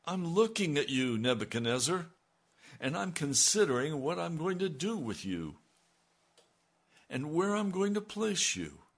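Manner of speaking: booming divine warning voice, pitched high; God's stern warning. Retold or imitated. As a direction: call it down on the king from above. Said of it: "he's being told".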